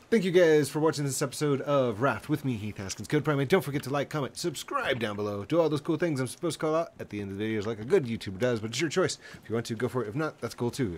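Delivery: loud, upbeat channel sign-off; YouTube outro. Acted. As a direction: wrap it up for the viewers.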